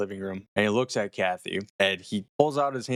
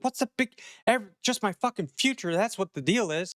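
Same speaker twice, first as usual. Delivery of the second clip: high-pitched mock tirade, half-shouted; hammed-up outraged wife losing it; retold freak-out, pitched way up; imitating freaking out; 10 semitones higher than usual. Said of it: "Kathy just starts freaking the fuck out".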